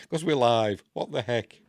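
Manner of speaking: hammed-up "we're live" in a put-on voice; mock alarm. Joking to viewers about being live, mock alarm.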